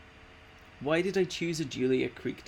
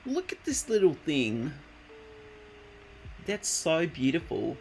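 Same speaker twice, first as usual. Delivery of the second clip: cooing; 4 semitones higher than usual. Real, not acted. Cooing over a cute animal.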